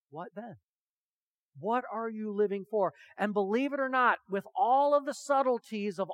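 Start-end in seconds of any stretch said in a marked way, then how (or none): 0.1-0.6 a softly dropped, weighty question; hushed question. Real, not acted. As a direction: drop to a near hush to let it sink in. Solemn question after the story.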